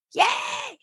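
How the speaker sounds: high squealing yay; excited cheer. Retold or imitated. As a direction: cheer it out, thrilled. Retold pitch, cheering at good news.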